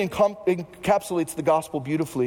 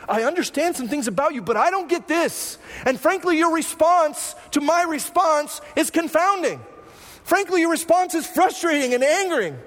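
frustrated prayer; 9 semitones higher than usual. Retold or imitated. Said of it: "our response ... is just like this".

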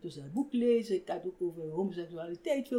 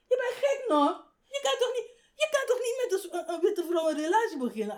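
much higher, mock-shrill incredulous professor; 10 semitones higher, faster than usual. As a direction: squeak it out like a scandalised academic. Professor scolds Astrid, mocked.

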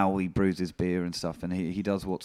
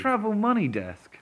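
phone menu voice; 11 semitones higher than usual. Retold or imitated. Echoing the automated Tesco menu.

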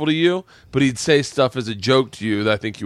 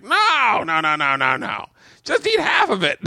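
high, drawn-out mock protest; imitated comic waving-away; dismissive insistence; 7 semitones higher than usual. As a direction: brush off the objection in a higher voice. Club owner waving off health objection.

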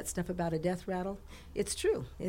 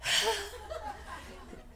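high, drawn-in revival gasp; imitated sudden gasp; 13 semitones higher, 7 dB quieter than usual. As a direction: suck in air as if coming back to life. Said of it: "And then Mom went".

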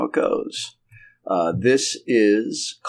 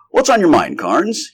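higher, louder friendly question; inquisitive doctor; 10 semitones higher, 6 dB louder than usual. Acted. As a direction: ask your friend with lively interest. Doctor greets friend with concerned question.

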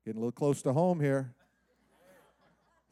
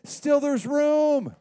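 excited servant; 8 semitones higher, 4 dB louder, much slower than usual. Retold or imitated.

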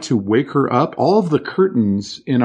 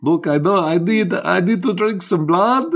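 higher-pitched vampire with rising endings; upspeak vampire; 6 semitones higher than usual. Acted. Host plays along as vampire needing blood, high upspeak voice. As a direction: lift "blood" into a question. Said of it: "Is that upspeak?".

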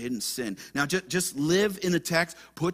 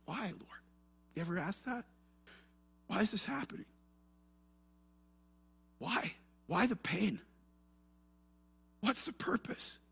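much softer, searching questions to God; hushed plea; 6 semitones higher, 5 dB quieter than usual. Acted. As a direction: ask the Lord quietly, almost to yourself.

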